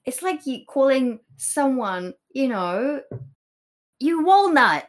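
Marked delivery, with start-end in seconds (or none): 4.0-4.9 loud, high mock insult. Friend mock-insulting someone as a walnut, loud and high. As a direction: fling the word like a silly insult.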